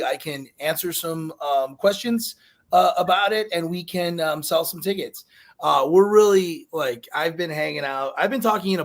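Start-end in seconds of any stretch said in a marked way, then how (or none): none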